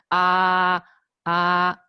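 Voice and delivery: loud "ah" as for a doctor; open-mouthed, sustained level "ah"; first tone. Shown as a demonstration. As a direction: hold "ah" out loudly on a level pitch. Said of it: "doctor will ... ask you to say something".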